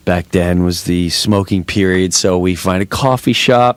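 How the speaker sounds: ordinary talk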